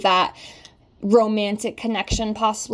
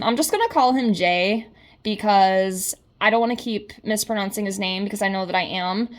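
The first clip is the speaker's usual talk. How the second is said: usual speaking voice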